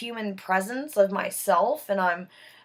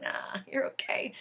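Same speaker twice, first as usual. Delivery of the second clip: whispered brush-off; 11 dB quieter than usual. Retold or imitated. Friend brushing it off.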